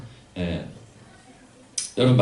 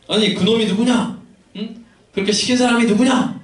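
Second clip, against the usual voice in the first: demanding; 8 semitones higher than usual. Retold or imitated. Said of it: "라고 따지는 거예요".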